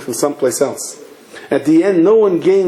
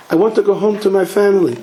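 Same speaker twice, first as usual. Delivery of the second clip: higher voice, falling end on "family"; insistent statement; 6 semitones higher than usual. Acted. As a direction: plead your case, settling down on "family". Visitor's statement, echoed as a question.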